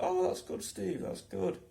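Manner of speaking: high, patronising mock praise; humouring. Humouring Steve about his gear, mock praise. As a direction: pat him on the head with words.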